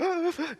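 high, hitching mock sob; crying gasp. Man overcome in the balcony, mock sobbing gasp. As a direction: imitate a convulsive crying gasp. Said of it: "and I'm crying even more".

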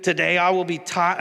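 horrible Southern preacher impression; Joel Osteen. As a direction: recite it like a Southern televangelist's creed. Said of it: "I do a horrible Southern accent".

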